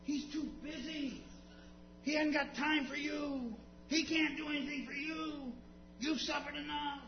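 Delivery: high-pitched mock hushing voice; high, put-on shooing voice; scolding crowd. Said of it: "The same ones that said".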